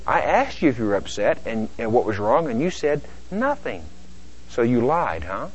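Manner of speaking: soft, measured comeback; quiet reply. Acted. Partner answering upset spouse, quietly reproachful.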